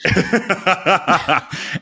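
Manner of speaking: long, high amused laugh; hearty laugh. Real, not acted. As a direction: burst out laughing. Laughs after cancel culture joke.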